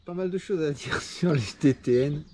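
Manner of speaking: real laugh breaking into the sentence. Real, not acted. Runner starting his race recap while friends crack up behind him, laughing. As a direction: try to carry on through a laugh. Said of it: "Là, je rigole parce qu'en fait".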